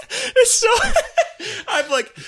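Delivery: high, breaking up with laughter; laughing. Real, not acted. Podcaster cracking up at his own embarrassing subway story, laughing. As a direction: barely get the words out through laughing.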